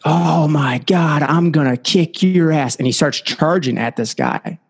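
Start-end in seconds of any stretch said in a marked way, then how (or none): 0.0-2.8 lightly acted drunk threat, drawn out; a slow mock threat from a big drunk; hint of a riled drunk man. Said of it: "the big drunk man was like".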